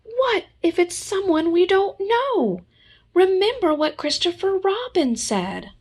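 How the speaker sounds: worried squeak. Acted. Piglet worried, high.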